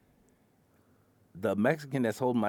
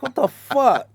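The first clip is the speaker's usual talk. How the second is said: high, loud what the fuck; exasperated shout; 12 semitones higher, 10 dB louder, slower than usual. Retold or imitated. Driver at signaller's waving, loud and high. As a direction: shout it in disbelief.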